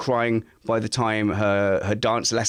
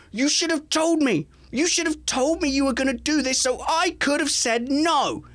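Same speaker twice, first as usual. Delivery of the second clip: put-on high, indignant scolding child; an eight-year-old scolding mum; child telling off. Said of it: "giving her the biggest telling off".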